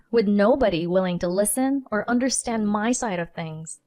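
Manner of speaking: ordinary delivery, nothing marked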